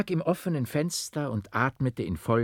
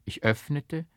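plain reading voice

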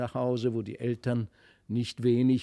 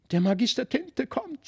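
higher, alarmed cry; frightened cry; 10 semitones higher than usual. A narrator's reading. Felix crying out in fear to his sister. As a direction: call out the danger in fear. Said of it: "schrie Felix".

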